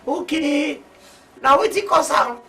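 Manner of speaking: very high-pitched outburst; loud exclamation. Acted. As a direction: cry it out high and loud after a shock. Shocked at reason for sacking.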